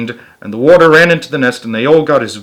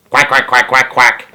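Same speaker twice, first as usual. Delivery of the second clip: duck quacking; 4 dB louder than usual. A narrator's reading. Duck quacks over the phone.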